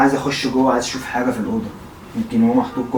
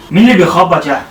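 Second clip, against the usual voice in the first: calling out; 4 semitones higher, 7 dB louder than usual. Real, not acted. Calling out over knocking.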